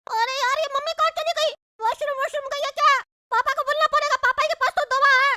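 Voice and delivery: put-on high-pitched whining child voice; squeaky kid complaining of a bellyache; high, plaintive cartoon child; little boy in pain. Child character with stomach ache.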